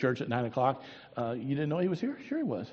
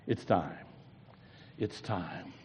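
hushed; 7 semitones lower, 9 dB quieter, slower than usual. Acted. Jesus quietly announcing fulfillment.

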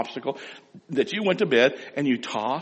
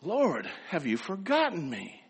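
pleading; 4 semitones higher, 6 dB quieter, slower than usual. Retold or imitated. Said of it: "pleading his case".

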